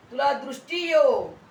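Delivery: high, loud proclamation; imitated healing command. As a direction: pronounce sight with authority. Preacher voices Jesus commanding healing. (अधिकाराने दिलेली मोठ्या आवाजातली आज्ञा)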